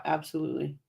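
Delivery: losing voice, hoarse and strained; hoarse, fading voice. Real, not acted. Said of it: "guys, I'm losing my voice".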